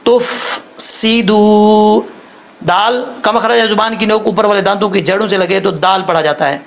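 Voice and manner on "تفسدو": slow تفسدو with hissed s and long oo; careful recitation-lesson TUF-SEE-DOO; drawn-out tajweed demo. Shown as a demonstration. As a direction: stretch each syllable of تفسدو. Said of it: "تھوڑا سا gap رکھ کے پڑھنا ہے".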